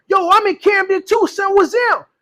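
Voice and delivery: put-on high, excited New York accent; hammed-up New York street voice. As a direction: imitate an eager New Yorker. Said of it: "my New York accent".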